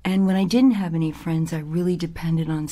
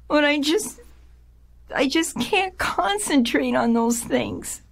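put-on tearful child; 8 semitones higher than usual. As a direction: relive the hurt of failing as a child. Reliving crying after failing.

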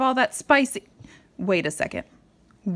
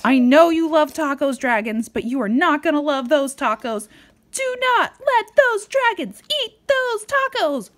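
louder, higher, pleading to the dragons; urgent warning; 8 semitones higher, 4 dB louder than usual. Acted. Parent warning the dragons in the story, louder and urgent. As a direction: warn them urgently not to eat.

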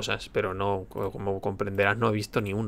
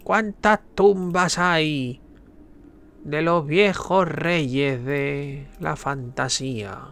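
sneering panto villain; 4 semitones higher, much slower than usual. Acted. Poses riddle dramatically.

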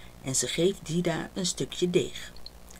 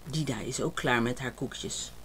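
plain reading voice

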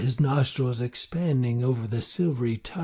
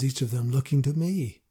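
ordinary delivery, nothing marked

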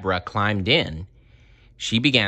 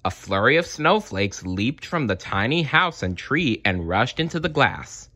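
plain reading voice

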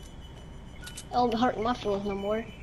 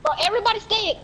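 higher, urgent order; excited command; 10 semitones higher than usual. Real, not acted. Ordering teammates.

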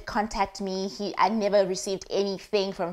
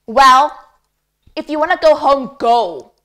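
loud, curt dismissal; cold boyfriend telling her to leave, loud; retold harsh retort; 5 semitones higher, 12 dB louder than usual. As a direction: throw it at her like a door slammed.

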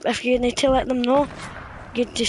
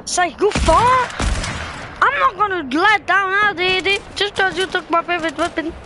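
riled-up yell; 5 semitones higher than usual. Real, not acted. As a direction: push back hard at the teammate who took your weapon. Angry at teammate over weapon.

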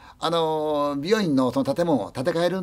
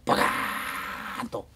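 slow breathy "バカーン" for a far explosion; breathy blast; 4 semitones lower, 7 dB quieter, much slower than usual. Retold or imitated. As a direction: let the boom roll out in a breath. 弾薬庫の爆発音. (息まじりの爆発音)

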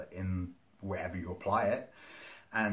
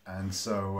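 normal talking voice

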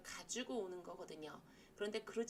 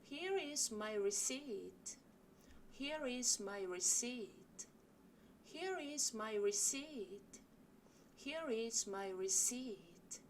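slow model sentence; much slower than usual. Shown as a demonstration. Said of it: "같이 이 문장 연습해 보실게요".

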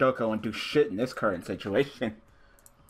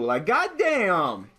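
drawn-out goddamn; 6 semitones higher, much slower than usual. Real, not acted. Drawn-out exasperation.

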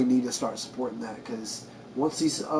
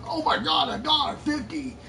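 mock astonishment; 12 semitones higher than usual. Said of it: "And you were like".

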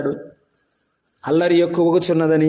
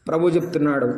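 usual speaking voice

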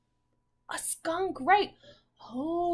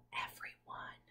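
whisper; 13 dB quieter than usual. Real, not acted. Teacher's soft aside.

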